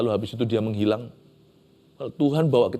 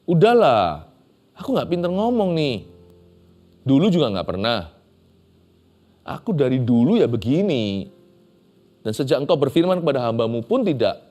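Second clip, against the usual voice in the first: higher and louder whiny pleading; complaining Moses; 5 semitones higher, 3 dB louder than usual. Acted. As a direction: whine like a servant begging off the job. Preacher acts out Moses' excuses.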